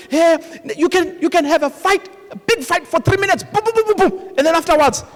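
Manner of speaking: normal talking voice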